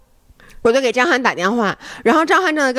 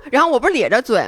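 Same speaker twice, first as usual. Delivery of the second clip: usual speaking voice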